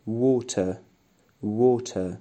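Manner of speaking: British water with a clear t, no r. Shown as a demonstration. Teacher modelling British water for students.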